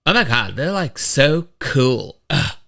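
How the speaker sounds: slow, mocking teen voice; mock the bikers like a sarcastic teen; a hint of a sarcastic daughter drawling. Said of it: "in her mocking voice".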